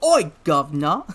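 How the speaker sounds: loud mock Cockney hail; hammed-up Cockney greeting; Cockney accent. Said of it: "used oi".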